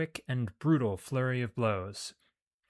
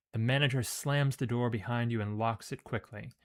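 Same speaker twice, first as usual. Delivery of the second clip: usual speaking voice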